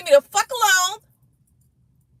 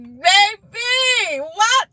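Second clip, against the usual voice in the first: shocked cry; 5 semitones higher, much slower than usual. Real, not acted. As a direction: yelp it out in disbelief. Disbelief at jail news.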